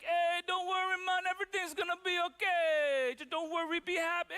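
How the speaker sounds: bad Jamaican accent. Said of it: "really bad, like, Jamaican Italian accent".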